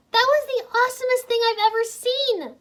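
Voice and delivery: high, loud delighted gush; happily. A narrator's reading. Irene praises Wu after the dragon ride, high happy gush. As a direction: gush with joy. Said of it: "Irene said happily".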